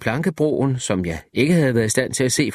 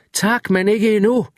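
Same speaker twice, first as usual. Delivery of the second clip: shouted; 9 semitones higher than usual. A narrator's reading. Narrator calls a refusal across the beach, raised and higher. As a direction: call it out to him over the distance. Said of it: "råbte jeg".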